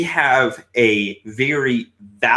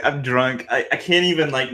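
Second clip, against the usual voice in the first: normal talking voice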